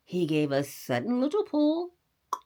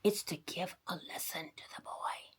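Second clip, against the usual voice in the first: soft unvoiced whisper; 10 dB quieter than usual. A narrator's reading. Bunny whispers the secret plan to the doctor. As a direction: breathe it secretly. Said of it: "whispered the bunny".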